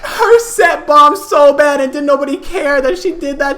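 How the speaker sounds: high, laughter-strained voice; laughing while telling. Real, not acted. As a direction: gasp out the summary while cracking up. Teller laughing at closing remark.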